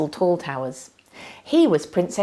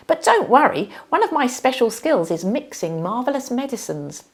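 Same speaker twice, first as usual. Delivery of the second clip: higher, bright capable princess; breezy reassurance; 7 semitones higher than usual. Acted. Sky Blue reassures Minna she can mix a cure, high and bright. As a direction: cheerfully take charge.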